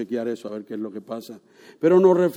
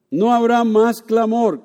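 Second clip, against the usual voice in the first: slow, loud preached line; louder slow proclamation; preaching; 6 semitones higher, 6 dB louder, slower than usual. Shown as a demonstration. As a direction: proclaim it slowly from the pulpit. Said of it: "No habrá más clamor, gracias".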